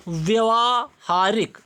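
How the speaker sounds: व्यवहारिक said very slowly, each syllable apart; slowly dictated व्यवहारिक; slow spelling out. Shown as a demonstration. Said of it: "इस type से लिखा था".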